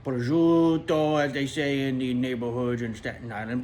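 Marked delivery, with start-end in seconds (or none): 0.0-1.2 loud, very drawn-out food name; hammed-up foodie relish; savoring. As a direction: stretch the vowels with big relish. Savoring a list of sandwich foods.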